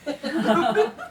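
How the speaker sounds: breathy high giggle; real laugh. Real, not acted. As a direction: laugh at your own joke. Teller laughs at own line.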